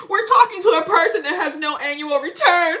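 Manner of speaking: loud, high announcement breaking into laughter; real laughing outburst; laughing mockery. Real, not acted. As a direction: crow it out loudly, cracking up. Mocking someone's savings, bursting into laughter.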